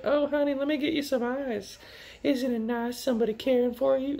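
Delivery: put-on very high, childlike cooing voice; baby voice. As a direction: coo it like a little girl playing mommy. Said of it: "except with a baby voice".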